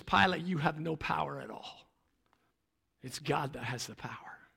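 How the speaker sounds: hushed reply. Acted. Jesus answers Pilate quietly.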